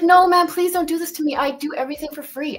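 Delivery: high, loud desperate plea; pleading. Retold or imitated. Streamer retelling begging the webmaster, pleading high and loud. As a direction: beg him not to do this.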